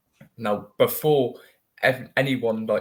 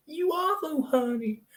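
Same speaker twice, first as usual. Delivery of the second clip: much higher, sing-song American voice; mock American lady. American stranger insisting she's English, much higher and cooing. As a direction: coo it like a sweet, insistent American.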